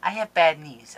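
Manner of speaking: ordinary talk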